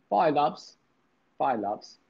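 British accent. Shown as a demonstration. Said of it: "with a British accent".

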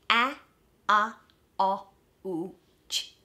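"Ouch" broken into "A, a, o, u, ch"; individual sounds. Shown as a demonstration. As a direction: sound out each piece separately, with gaps. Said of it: "hitting each of those individual sounds".

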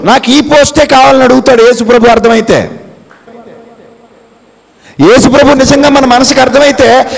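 high, loud preacher's proclamation; full-force preaching. Acted. Preacher telling the congregation their true place, loud and high. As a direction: proclaim it to the whole hall.

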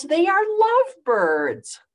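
high, loud excited shout; Piggy shouts. A narrator's reading. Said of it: "Piggy shouts".

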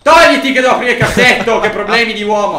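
loud, high-pitched exasperated outburst. Real, not acted. Player to man blocking the drawer, exasperated. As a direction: order him out of the way, exasperated.

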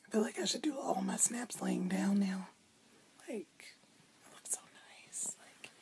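soft whisper lying down; breathy late-night hush. Real, not acted.

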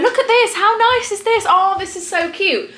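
very high, delighted showing off; gushing. Pretend. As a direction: squeal it to the boyfriend. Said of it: "going to my boyfriend".

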